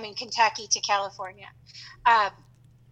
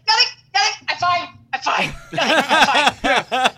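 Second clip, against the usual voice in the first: hurried, loud nervous denial; panicked denial; 3 semitones higher, 6 dB louder than usual. Retold or imitated. Boy hiding his pencil wound from the teacher, nervous. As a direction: cover it up fast and breathless. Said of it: "he's like".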